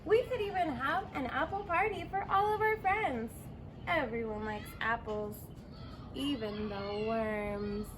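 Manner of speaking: plain reading voice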